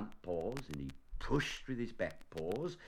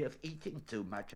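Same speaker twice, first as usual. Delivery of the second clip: mildly stern, softer retort; a touch of reproach, pitched up; 5 semitones higher, 5 dB quieter than usual. A narrator's reading. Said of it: "said Rabbit sternly".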